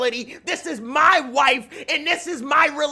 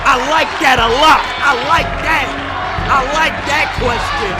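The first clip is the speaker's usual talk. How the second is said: loud, thrilled yelling; hyped shout; 6 dB louder than usual. Real, not acted. Loving the drama of the question, loud and thrilled. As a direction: cheer it like a great play.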